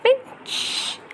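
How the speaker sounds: a high, breathy whisper of the word; whispered pinch. Acted. Mother acting a crab pinch for baby, playful whisper. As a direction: hiss it out like a sneaky pinch.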